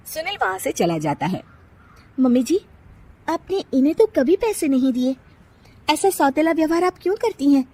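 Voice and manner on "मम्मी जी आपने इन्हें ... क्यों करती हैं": higher, challenging daughter-in-law; young bride confronting. Acted. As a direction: question the mother-in-law pointedly. Nisha confronts mother-in-law. (सास से सवाल करती बहू)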